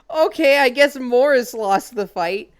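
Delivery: high, loud, incredulous reaction; mock amazement. Player reacting to Morris losing the fight, loud disbelief. Acting reaction to absurd knockout.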